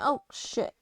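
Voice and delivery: ordinary talk, nothing marked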